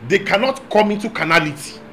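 ordinary delivery

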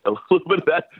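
laughing. Real, not acted. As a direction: repeat it high, breaking into a laugh. Repeats line while laughing.

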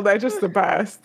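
ordinary delivery

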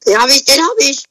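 excited shout. Real, not acted. Excited shouting mid-game.

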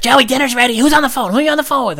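high, loud mom voice; nosy mom. Retold or imitated. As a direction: bark like a mom cutting in. Mom interrupts on the line.

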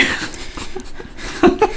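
high, breathy laugh that breaks the line; real giggle during a read; breaking into laughter. Real, not acted. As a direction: laugh out of character. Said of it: "En mä osaa".

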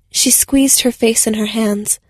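ordinary delivery, nothing marked

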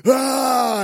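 sudden high, loud scream; acted monster "Ah!"; jump-scare yell. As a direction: burst out to make them jump. Acting the jump scare on kids.

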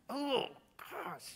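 ordinary delivery

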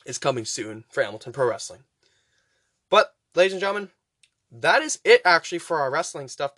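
ordinary delivery

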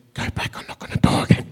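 soft whispered command from within; whispered inner voice. Retold or imitated. God's inner voice nudging the preacher, whispered. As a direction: breathe it like a quiet inner prompting.

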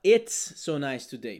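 slow, clear correct phrase; slow correct model. Shown as a demonstration. Teacher gives the correct version, slow. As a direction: model it carefully. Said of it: "o que que você vai falar".

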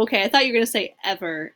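ordinary talk, nothing marked

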